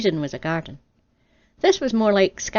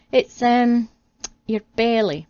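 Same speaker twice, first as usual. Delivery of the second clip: louder, higher hesitant admission; awkward confession; 4 semitones higher, 5 dB louder, slower than usual. Acted. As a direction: squirm out the bad news.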